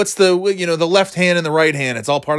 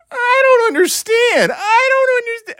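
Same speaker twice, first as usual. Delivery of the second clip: squeaky Tucker Carlson; 4 dB louder than usual. Retold or imitated. Podcaster ridiculing a TV host, loud squeaky wail. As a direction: squeal it like a wailing, baffled TV host. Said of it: "he sounds like a fucking squeaky fucking douche".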